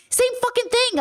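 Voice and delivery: mocking brother. Retelling to viewers, mimicking her brother's high dismissive voice.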